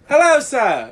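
exaggerated British accent, high and loud; Tan France. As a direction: greet in a badly imitated, high-pitched British accent. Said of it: "Is that his accent?".

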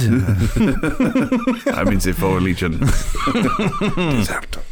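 put-on slow, laughing French accent; hammed-up French legionnaire. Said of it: "I'm just a Frenchman".

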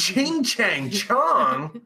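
very slow, drawn-out syllable by syllable. Retold or imitated. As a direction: drag each word out like quoting something dumb. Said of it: "He's making fun of Chinese people".

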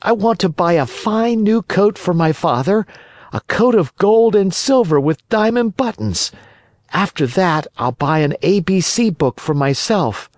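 high-pitched puppet listing his plans; high, childlike enthusiasm; eager puppet boy. Acted. Voices Pinocchio in the story.

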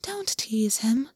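whispered, slow gentle rebuke; soft chiding whisper. Acted. Rabbit chides the bird, soft whisper. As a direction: hush the bird kindly. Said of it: "the rabbit quietly chided".

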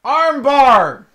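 sudden loud shout of a wrestling move; yelling from another room. Pretend. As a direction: bellow it like a fan in the next room. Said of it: "you'll yell shit from the other room".

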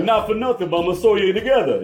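put-on high, fast New Jersey accent. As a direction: play a Jersey tough guy. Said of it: "thick New Jersey accent".

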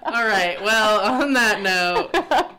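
slow, loud goodbye through laughter; can't stop laughing while wrapping up; laughing sign-off. Real, not acted.

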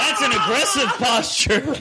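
laughing remark. Real, not acted.

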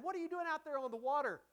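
hammed-up scolding question; mock rebuke. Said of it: "He doesn't say to them, rebuke".

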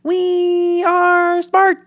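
high, very slow, put-on smug voice; smug politician mock. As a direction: condescend like a self-satisfied Democrat, dragging every word. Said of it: "They're just gonna be like".

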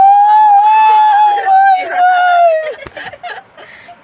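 shrieking laugh. Real, not acted.

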